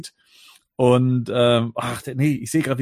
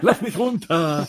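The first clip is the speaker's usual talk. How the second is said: lisping; 5 semitones higher, slower than usual. Retold or imitated. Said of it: "lispelt so ein bisschen".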